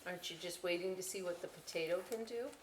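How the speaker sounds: ordinary delivery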